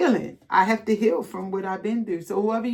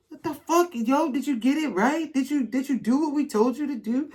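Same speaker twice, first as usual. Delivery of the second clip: high, startled mock disbelief; a lightly voiced outraged onlooker; shocked outsiders; 6 semitones higher than usual. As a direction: play the stunned gossip going "what the". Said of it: "these people are like".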